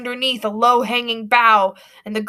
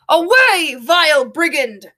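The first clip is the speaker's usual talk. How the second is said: high, loud, pompous cry; coachman's shout; 5 semitones higher, 4 dB louder than usual. A narrator's reading. Coachman shouts at the bandit girl, loud and pompous. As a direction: drive the bandit off with a bellow. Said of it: "shouts the coachman".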